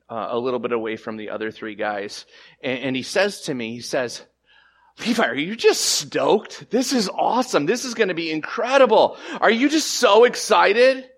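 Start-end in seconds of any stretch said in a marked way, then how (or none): 5.1-11.1 higher and louder, bubbling with excitement; eager friend. Retold or imitated. Pastor friend asking Levi at the store, excited. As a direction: gush like a buddy who can't wait.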